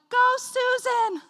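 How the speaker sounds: high, loud, drawn-out cheer; imitated cheerleader call; cheering bystander. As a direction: cheer a runner on from the roadside. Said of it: "this one cheerleader on the side".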